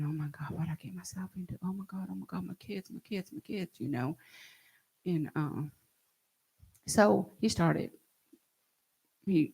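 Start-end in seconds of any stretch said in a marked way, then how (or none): 0.0-3.8 fast frightened whisper, repeating in panic; panicked whisper. Retold or imitated. Thinking of her children in terror, panicked whisper. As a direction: whisper it as if praying for her kids. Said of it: "I kept thinking".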